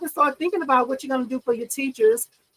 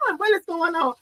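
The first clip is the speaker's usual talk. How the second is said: high mock confused viewer; high puzzled imitation of a viewer; 9 semitones higher, faster than usual. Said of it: "Y'all be like".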